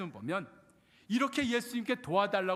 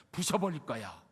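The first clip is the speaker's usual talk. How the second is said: mock menacing threat; 7 semitones lower than usual. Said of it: "제가 조금 너무했죠".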